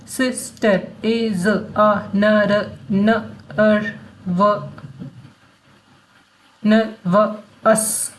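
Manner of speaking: very slow, sound by sound. Shown as a demonstration. Teacher sounding out a sentence for learners, very slow. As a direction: break each word into separate little sounds.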